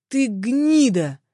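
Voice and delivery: slow, drawn-out angry roar; thundering insult. A narrator's reading. Man intervenes against the attacker, slow thundering fury. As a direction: thunder it out, stretching the words. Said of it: "прогремело рядом".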